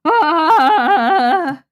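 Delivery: loud high cry of dismay; dismayed shriek. Real, not acted. Streamer yelps at her smudged art, dismayed shriek. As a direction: yelp as the smudge spreads. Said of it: "I'm sorry, Beauty Screaming Compilation".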